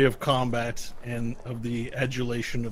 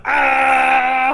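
high, loud drawn-out "ah"; acted falling scream; 10 semitones higher, 9 dB louder than usual. Game master plays the orc falling, loud scream. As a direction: cry out as a dying orc. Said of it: "he falls down".

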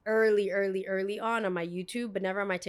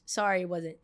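ordinary delivery

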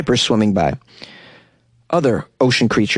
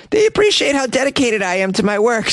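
put-on young woman voice; 10 semitones higher than usual. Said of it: "humbled by the support".

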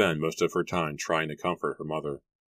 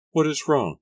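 much higher, louder, alarmed question; frightened demand; 10 semitones higher, 5 dB louder than usual. A narrator's reading. Mary sees Jeremy step back, frightened demand. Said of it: "Mary demanded, suddenly frightened".

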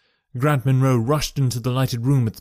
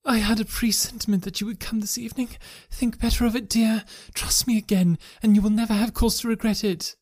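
pleading wife; 9 semitones higher than usual. A narrator's reading. Wife pleading with her husband at the door, higher and gentle. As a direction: coax him tenderly to turn back.

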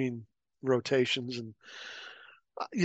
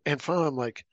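ordinary delivery

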